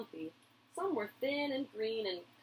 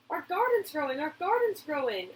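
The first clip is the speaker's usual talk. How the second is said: high, loud happy shout; child shouting with joy; excited yell; 7 semitones higher, 4 dB louder than usual. A narrator's reading. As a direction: call it out with delight. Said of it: "I yelled".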